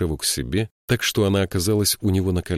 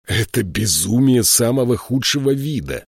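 louder, angry growl; 5 semitones higher, 4 dB louder than usual. A narrator's reading. Joshua growling at reckless Varsheva. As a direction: snarl it at her. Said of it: "прорычал Джошуа".

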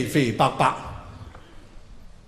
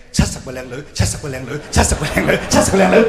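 a bomber chanting his hopes louder and louder before impact; 4 dB louder, faster than usual. Retold or imitated. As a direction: mutter then shout it, faster and louder. Chant building to crash.

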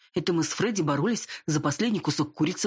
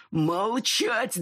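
angry; 6 semitones higher than usual. Acted. Wolf chief yells at her.

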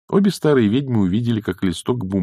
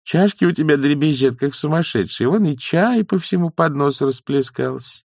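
lightly voiced higher nanny; fussing nanny; 8 semitones higher than usual. Nanny points out the rattling cups, lightly fussing. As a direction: fuss in a raised pitch.

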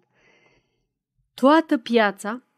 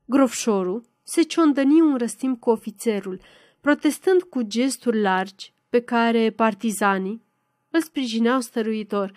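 ordinary delivery

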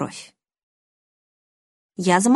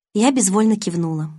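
ordinary delivery

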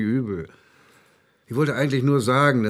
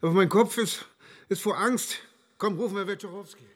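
higher and softer, trailing off in fear; frightened, fading; 6 semitones higher, 4 dB quieter than usual. Acted. Colleague confessing his fear, voice fading. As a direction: let fear choke the voice down.

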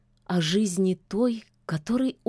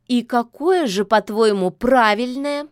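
frowning; 4 semitones higher, 6 dB louder than usual. A narrator's reading. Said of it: "нахмурилась Яга".

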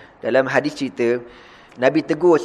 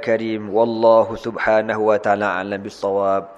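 lower, steady recitation; closing prayer; 4 semitones lower than usual. Acted. Preacher closing the session, lower steady recitation. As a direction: recite the closing duas evenly.

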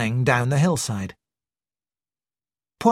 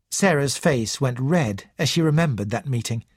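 ordinary delivery

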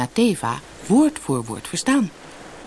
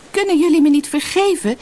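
high, loud plea; calling out; 12 semitones higher, 5 dB louder than usual. A narrator's reading. Sina begs the hidden sisters' forgiveness, calling loud. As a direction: beg loudly across a distance. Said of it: "riep Sina in de richting van de donkere rotsen".

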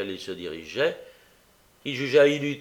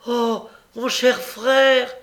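high, slow, drawn-out false sister's wail; fake tearful cry; 12 semitones higher, 9 dB louder, much slower than usual. A narrator's reading. Young woman staging a reunion cries out, high and theatrical. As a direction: cry out like a long-lost sister, overplayed. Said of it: "qui s'écria très haut".

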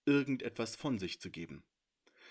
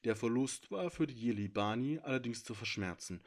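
ordinary delivery, nothing marked

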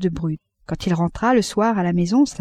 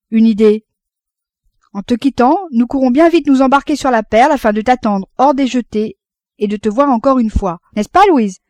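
eager father; 4 semitones higher, 6 dB louder than usual. A narrator's reading. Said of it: "s'écria son père".

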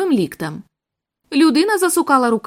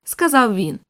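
ordinary delivery

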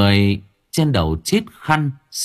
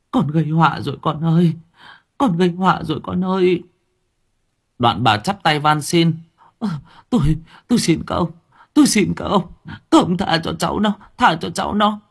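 higher, quick begging voice; pleading frightened mother; 7 semitones higher than usual. A narrator's reading. Terrified mother begging the servants to spare her son, quick and high.

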